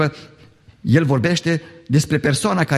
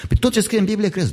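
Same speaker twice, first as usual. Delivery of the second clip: quick, higher scoffing question; mocking challenge; 8 semitones higher, faster than usual. Intellectual scoffing at the believing student, fast and higher. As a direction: needle her like a smug sceptic.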